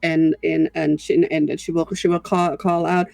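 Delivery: ordinary talk, nothing marked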